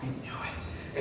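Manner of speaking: hushed, breathless yes; quiet gleeful whisper; whispered triumph. Retold or imitated. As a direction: whisper it to yourself in delight. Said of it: "and you say".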